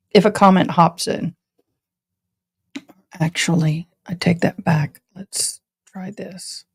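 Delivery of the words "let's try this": quiet. Real, not acted. Drops into whisper.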